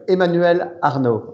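Emmanuel Arnault said the French way, with a uvular R and a silent final T; slow, careful French name; French pronunciation. Shown as a demonstration. Guest demonstrating his name, slow French pronunciation. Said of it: "I can give you the, the French way".

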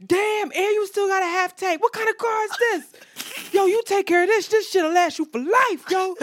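high, loud mimicked hype; obnoxious. Stranger marveling at her car for everyone to hear, obnoxious. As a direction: go big and high as the over-impressed man. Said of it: "so obnoxious".

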